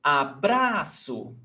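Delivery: "Abraço" slow, with a long middle syllable; penultimate stress. Shown as a demonstration. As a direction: say "Abraço" as a-BRAA-ço. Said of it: "before last".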